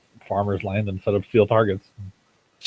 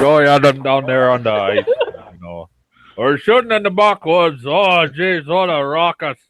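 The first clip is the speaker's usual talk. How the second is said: put-on higher, louder Minnesota accent with drawn-out vowels; imitating Minnesota neighbor; 4 semitones higher, 3 dB louder, slower than usual. Imitating his neighbor's Minnesota accent for friends. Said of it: "my neighbor talks like that".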